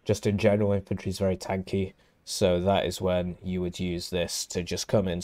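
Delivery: ordinary talk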